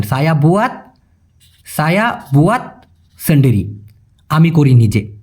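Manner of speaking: normal talking voice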